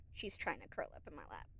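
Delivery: soft whisper so the cat stays settled. Real, not acted. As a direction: hush it so the cat keeps sleeping.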